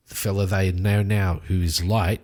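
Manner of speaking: usual speaking voice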